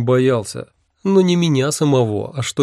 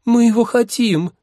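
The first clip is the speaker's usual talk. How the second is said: put-on very high girlish demand; squealing lovestruck maiden; chirping girls; 12 semitones higher, 4 dB louder than usual. A narrator's reading. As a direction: beg papa in a high chirp. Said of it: "хором защебетали эти изумительные барышни".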